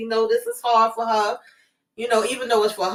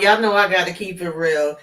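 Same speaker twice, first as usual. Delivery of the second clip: ordinary delivery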